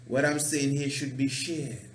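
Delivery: ordinary delivery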